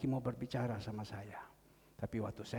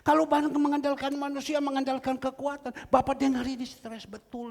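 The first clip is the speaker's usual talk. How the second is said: higher, louder sermon cadence; fiery preaching; 11 semitones higher, 12 dB louder than usual. Acted. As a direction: rouse the congregation with certainty. Preaching encouragement at full force. (berkhotbah dengan semangat dan suara lantang)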